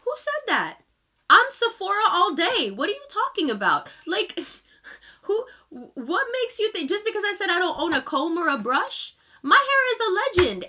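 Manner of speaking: high-pitched, incredulous comeback; indignant. Real, not acted. Answering a troll's makeup insult, indignant. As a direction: pitch leaps up in offended disbelief.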